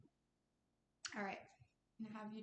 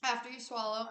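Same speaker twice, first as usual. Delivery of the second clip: normal talking voice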